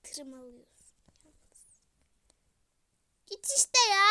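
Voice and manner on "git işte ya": higher, much louder shout; angry yell. Real, not acted. To her brother barging into the room, furious yell. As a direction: scream at him to get out.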